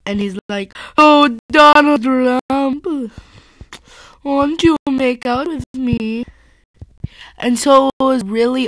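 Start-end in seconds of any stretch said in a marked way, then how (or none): 1.0-6.2 high, loud, slow put-on accent as Trump's wife; weird mock accent. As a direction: swoon the name out slowly in a silly accent. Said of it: "pretending to be Donald Trump's wife".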